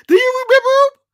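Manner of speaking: high, loud disbelieving question; incredulous. Real, not acted. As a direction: ask in total disbelief.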